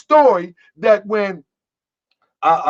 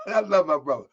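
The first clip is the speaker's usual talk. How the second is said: normal talking voice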